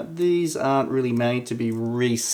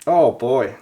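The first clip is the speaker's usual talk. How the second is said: ordinary delivery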